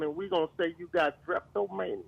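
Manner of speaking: normal talking voice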